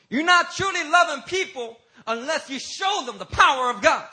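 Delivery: high, loud, shouted preaching; full-force preaching. Real, not acted. Preacher challenges the church to believe in God's power, impassioned preaching. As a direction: drive it home at full voice.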